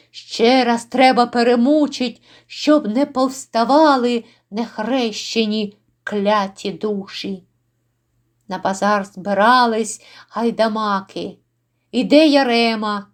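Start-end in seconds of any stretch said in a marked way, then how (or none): none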